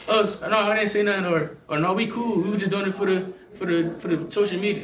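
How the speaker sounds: high, meek voice taking it all back; backpedaling internet tough guy. Retold or imitated. Boxer mocking online trolls who backpedal in person, high and meek. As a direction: play the online talker caught face to face, nervous. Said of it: "They the same ones when you see them in person".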